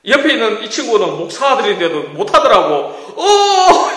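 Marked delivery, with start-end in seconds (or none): 3.2-3.9 high, loud startled shout; frightened yelp. Retold or imitated. Friend at the wheel, yelping high and loud. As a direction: yelp in terror.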